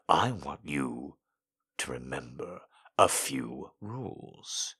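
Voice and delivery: low, soft, commanding male voice; quiet menace, deep and slow; stern dominant man. A narrator's reading.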